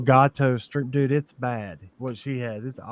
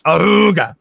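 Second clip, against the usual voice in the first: mock ooga grunt; 5 semitones higher, 9 dB louder than usual. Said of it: "One of them was like".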